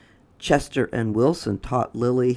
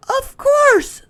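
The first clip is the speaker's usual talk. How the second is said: very high, louder put-on voice; Lily's voice; 8 dB louder than usual. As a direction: pipe the line way up high. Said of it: "said Lily".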